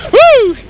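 high, loud whoop of joy; excited whoop. Real, not acted. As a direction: whoop it out, thrilled. Whooping after a great session.

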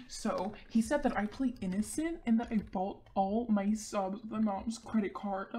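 ordinary talk

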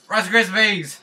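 high, loud yell of Rice Krispies; shouted catchphrase. Pretend. Gamer yelling his running joke at viewers, high and loud. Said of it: "You're gonna hear it again".

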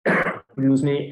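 ordinary talk, nothing marked

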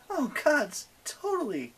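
high-pitched put-on heroine agreeing; gushing agreement. A narrator's reading. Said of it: "Annabeth agreed".

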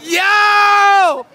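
loud call across the room; shout. Real, not acted. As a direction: yell to get attention. Calling out across the studio.

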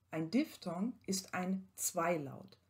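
ordinary talk

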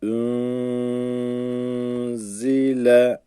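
ün drawn out, zile chanted; tajwid recitation. Shown as a demonstration. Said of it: "Tecvidli okunuşunu yapayım".

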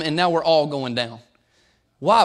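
usual speaking voice

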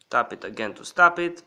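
normal talking voice

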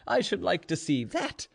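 high voice with laughter in it; amused. A narrator's reading. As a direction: laugh as you challenge him. Gregory laughing at Ivan's boast.

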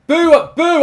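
high, loud jeering boos; loud booing. Real, not acted. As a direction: boo your own side hard. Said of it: "I'm booing".

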